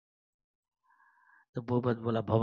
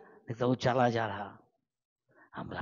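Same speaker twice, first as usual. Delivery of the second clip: usual speaking voice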